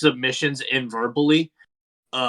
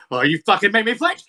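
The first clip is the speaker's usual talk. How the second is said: higher, louder imitation of an angry wrestler; mock angry outburst; 7 semitones higher, 5 dB louder, faster than usual. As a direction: act out his indignation, half in fun. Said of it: "it makes Suzuki so mad".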